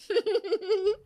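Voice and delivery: a high cheeky giggle; mocking laugh. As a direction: laugh at the old woman.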